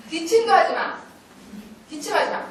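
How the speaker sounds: high, sharp order, re-enacted; retold scolding shout. Teacher stopping a child's deliberate coughing, scolding shout. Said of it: "계속 소리 질렀어".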